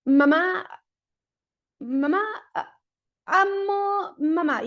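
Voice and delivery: "mamá, mamá" repeated, then "amo mamá" slow and high; struggling to join two words; a child with apraxia stuck before "amo mamá"; halting effortful phrase. Shown as a demonstration. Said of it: "que diga".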